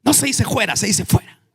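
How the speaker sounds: high-pitched protesting devil; comic high devil voice; the devil correcting. Acted. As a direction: imitate a fussy devil, pitched well up. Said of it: "El diablo le dijo".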